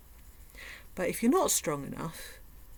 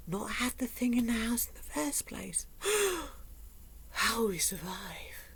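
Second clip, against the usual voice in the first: hushed, sarcastic reverent whisper with a gasp; mock awed whisper; 3 semitones higher, 3 dB quieter than usual. Mocking the obvious diet tip in a hushed awed voice. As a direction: whisper it like a sacred secret, mockingly. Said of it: "sarcastic mock revelation".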